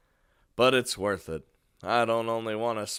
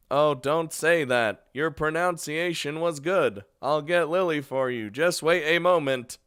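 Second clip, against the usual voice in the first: higher, louder robotic phone voice; computer robot default; 5 semitones higher, 5 dB louder than usual. Acted. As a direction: play the helpful host as a computer robot. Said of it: "defaulting to fucking computer robot".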